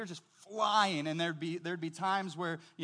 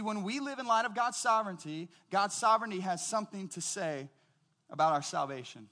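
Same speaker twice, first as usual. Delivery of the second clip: ordinary talk, nothing marked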